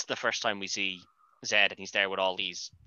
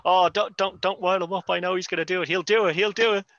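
high, louder, urgent pleading; mock frantic warning; 9 semitones higher, 5 dB louder than usual. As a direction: play the alarmed partner begging him to stop. Acting out a frantic film warning.

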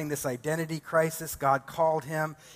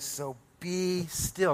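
slow hushed command; 4 semitones higher, much slower than usual. Acted. Psalmist's command quoted in sermon.

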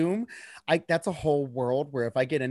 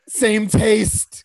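gushing; 4 semitones higher, 6 dB louder, much slower than usual. Retold or imitated. Gushing over a statue.

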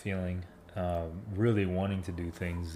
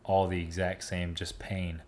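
normal talking voice

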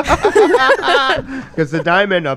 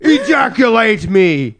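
laughing plea; 7 semitones higher, slower than usual. Acted. Comic outburst in banter.

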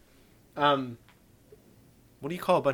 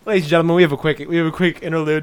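announcer voice; 6 dB louder, faster than usual. Acted. Announcer introduction.

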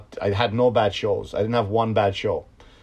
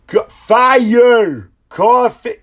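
high, drawn-out imitated yell; loud put-on shout, far above normal pitch; 13 semitones higher, 11 dB louder, much slower than usual. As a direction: holler it like an excited moviegoer. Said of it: "the guy to just yell out".

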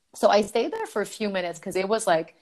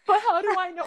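very high-pitched mock panic; panicked pleading; 12 semitones higher, faster than usual. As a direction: act out desperate doubt, voice pitched way up. Retold panicked pleading in toilet.

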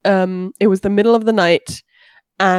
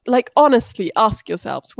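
usual speaking voice

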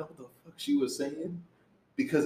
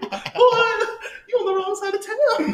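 high, loud, joking challenge; mock calling out; 6 dB louder, slower than usual. Joker warns two men off his turf, high mock shout. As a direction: holler it high with laughter in it.

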